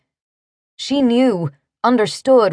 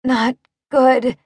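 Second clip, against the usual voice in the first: higher, halting gasp; 4 semitones higher than usual. A narrator's reading. Woman seeing blood before fainting, gasped. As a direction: gasp it in two broken pieces. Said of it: "She gasped".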